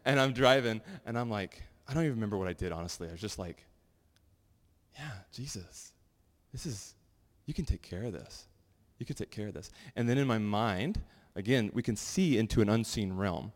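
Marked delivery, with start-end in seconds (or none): 5.0-9.7 quiet prayer. Retold or imitated. Quiet prayer while driving.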